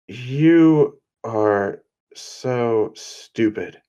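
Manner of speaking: slow, drawn-out scolding; slow, deliberate put-down; exasperated. Acted. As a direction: stretch each word out in exasperation. Cammy scolds Deku in an acted quarrel.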